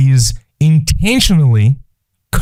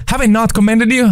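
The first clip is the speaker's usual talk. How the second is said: high, quick, insistent question; playful reproach, pitched up; God; 10 semitones higher, faster than usual. Acted. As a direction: remind them pointedly, in a high voice. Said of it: "I've told you that, right?".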